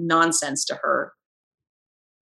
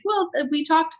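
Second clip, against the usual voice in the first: higher, sweet and polite; nice; 9 semitones higher than usual. Pretend. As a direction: keep it friendly and reasonable. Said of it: "like, nice about it".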